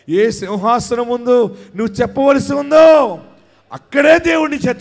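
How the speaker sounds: high, loud, rising sermon cry; fiery preaching. Acted. Pastor prophesying to the congregation, preaching at full force. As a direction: build the crowd up to a roar.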